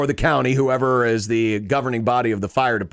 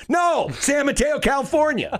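exasperated correction; 13 semitones higher than usual. Retold or imitated. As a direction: yell it out, fed up with being misheard. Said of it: "he's like".

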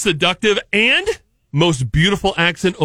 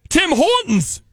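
high-pitched mock Canadian; Canadian accent; 9 semitones higher, slower than usual.

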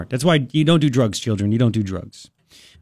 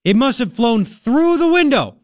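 higher, slow, innocent-sounding alibi; mock suspect's excuse; 6 semitones higher than usual. Host mimicking a suspect's excuse to police, higher and slow. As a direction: play dumb with a far-fetched excuse. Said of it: "She said, quote".

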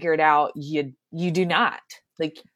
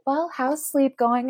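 doctor's question; 7 semitones higher than usual. Retold or imitated. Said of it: "the doctor's like".

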